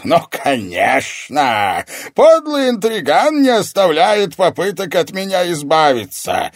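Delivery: mock the schemer at full volume; booming villain's derision; scornful sorcerer. A narrator's reading. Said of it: "загремел хриплый лающий смех".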